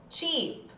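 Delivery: "Cheap" with a long ee and a ch start; model pronunciation. Shown as a demonstration. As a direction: say "Cheap" with a clear long I sound. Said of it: "A primeira foi mais ou menos isso". (pronúncia modelo de "Cheap")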